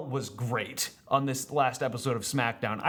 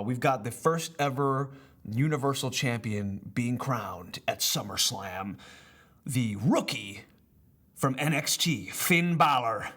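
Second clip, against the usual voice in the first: ring announcer; slower than usual. Acted.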